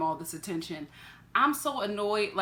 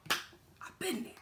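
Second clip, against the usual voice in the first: whispered knowing aside; hushed admission; whispered confession; 7 semitones higher, 10 dB quieter, slower than usual. Real, not acted. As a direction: breathe it like a secret.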